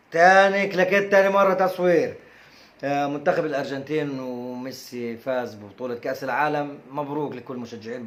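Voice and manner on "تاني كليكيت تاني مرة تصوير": clapperboard call. Pretend. Mock clapperboard call, retake.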